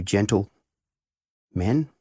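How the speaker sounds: "gentle" then a clear pause before "men"; gentle men with a space. Shown as a demonstration. Headmaster showing boys how he spaces "gentle men". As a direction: split "gentle" and "men" with a deliberate gap. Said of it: "when you hear me put the space".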